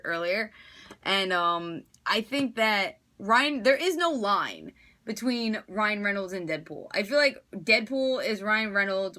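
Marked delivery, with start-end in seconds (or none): none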